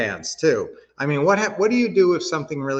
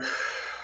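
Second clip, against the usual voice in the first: short soft release of breath; exhale; 11 dB quieter than usual. Shown as a demonstration. Coach leading deep breaths, short exhale. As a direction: let the breath go. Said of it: "Hold it a little bit and".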